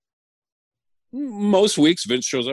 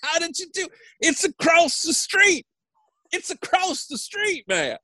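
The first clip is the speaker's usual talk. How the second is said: Michael Hayes laughing. Retold or imitated. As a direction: imitate helpless laughter, very high-pitched. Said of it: "He cannot stop laughing at me. He's just like".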